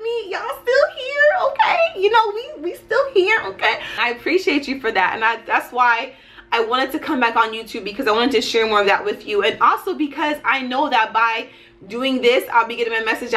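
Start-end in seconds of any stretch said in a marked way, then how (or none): none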